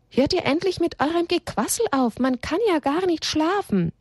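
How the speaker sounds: high-pitched, exasperated grumbling from above; indignant story-voice of a disturbed sleeper; annoyed complaint. A narrator's reading. Sleeper complains about the chatter.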